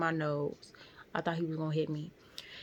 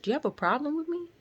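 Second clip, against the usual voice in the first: sharp, fast, much higher and louder challenge; confrontational boss; 10 semitones higher, 4 dB louder, much faster than usual. Retold or imitated. Boss confronting his employee, snapping fast and high. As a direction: boss squares up at the nurse, demanding in a fast high voice. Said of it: "He was like".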